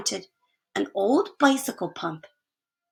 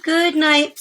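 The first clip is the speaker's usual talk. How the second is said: put-on higher, louder boys' good night; cheerful good night; 7 semitones higher, 6 dB louder than usual. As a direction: call it brightly from bed.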